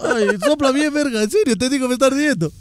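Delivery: más agudo y quejoso, con el ojo ardiendo; suplicando con dolor. Real, not acted. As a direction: pide ayuda quejándose del ardor. Le arde el ojo. (quejido agudo porque le arde el ojo)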